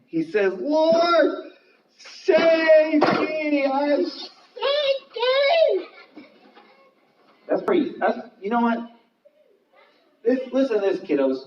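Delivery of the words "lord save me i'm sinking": very high, drawn-out drowning wail; crying out in fear. Acted. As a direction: scream for rescue as you go under. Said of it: "he's crying out again".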